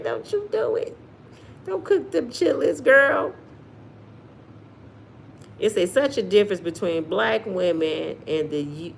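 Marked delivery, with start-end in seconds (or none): 0.0-3.3 Don't you do it pitched way up, pleading; high teasing warning. Real, not acted. Begging Miss Sophia not to cook chitlins, high pitched. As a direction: squeal the warning, half joking.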